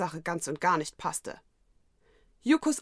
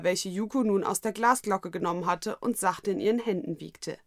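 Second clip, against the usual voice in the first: plain reading voice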